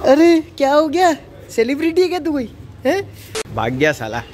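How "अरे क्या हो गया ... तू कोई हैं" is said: high, loud teasing shock; mock surprise. Real, not acted. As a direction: act stunned he ignores you. Kid runs off from vlogger. (नकली हैरानी से ऊँची आवाज़ में)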